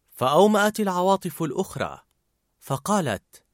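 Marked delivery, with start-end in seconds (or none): none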